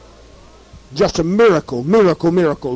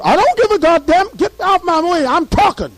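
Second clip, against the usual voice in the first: defiant shout; 12 semitones higher than usual. Retold or imitated. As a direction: bark back at the officer. Defying the army radio officer.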